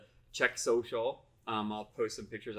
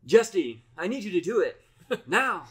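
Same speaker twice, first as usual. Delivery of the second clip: urgent captain order, louder and higher; Star Trek captain; 8 semitones higher, 8 dB louder than usual. Retold or imitated. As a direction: bark the order like a starship captain. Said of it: "No, Captain".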